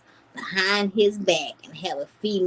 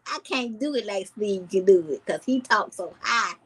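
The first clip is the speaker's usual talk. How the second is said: ordinary talk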